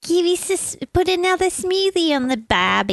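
high, hammed-up Australian accent; mock Australian accent. Host showing off for an accent lover in mock Australian. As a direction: put on a high, silly Aussie accent. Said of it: "You said you love accents, right?".

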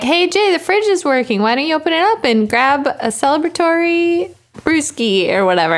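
high, sweet and innocent; innocently offering a beer; fake friendly. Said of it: "If you're like".

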